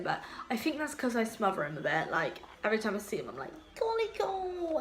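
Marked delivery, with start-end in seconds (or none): 3.8-4.8 high, drawn-out pet-calling voice; cooing at a cat. Retold or imitated. Owner fussing over her beloved cat, high cooing. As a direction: coo it high and slow, smothering a cat with love.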